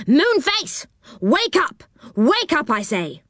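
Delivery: high-pitched, demanding wake-up call; insistent shouting. A narrator's reading. Angry man at the window wakes a sleeper, insistent calling. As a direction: call out sharply to rouse a sleeper. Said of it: "came shouting up to Moonface's".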